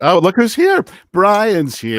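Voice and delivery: high, bright hello to a friend; light up as he joins; excited greeting. Real, not acted. Host welcomes friend Brian joining the show, excited.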